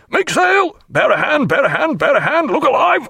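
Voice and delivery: high, urgent repeated shouts; bawled orders. A narrator's reading. Captain at the wheel to crew, bawling urgently. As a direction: bawl at the crew to hurry. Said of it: "bawling out".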